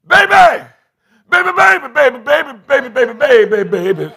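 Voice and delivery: put-on high, loud frantic calls of baby; desperate hollering. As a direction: holler for her like she's slipping away. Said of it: "he hollering for her".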